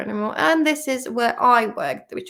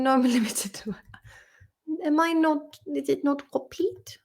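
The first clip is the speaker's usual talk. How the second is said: ordinary talk, nothing marked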